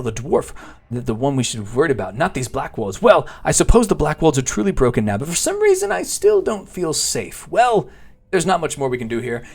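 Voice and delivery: hammed-up constable, lively swings in pitch; worried village constable. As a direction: play an earnest, fussy quest-giver, swooping high. Reads the constable's quest text in character.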